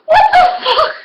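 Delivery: high, loud laughing yell; laughing shriek. Real, not acted. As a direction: shout it through a laugh. Shocked at her mirror image.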